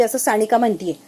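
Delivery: ordinary talk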